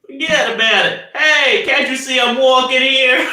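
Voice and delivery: high, loud New Yorker voice; hammed-up yelling New York accent. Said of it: "It's how I do the voice".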